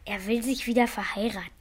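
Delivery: quick whisper; whispered aside. Real, not acted. Son whispering to the family about father remarrying. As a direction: hiss it quickly behind his back.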